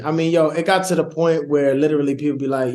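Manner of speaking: normal talking voice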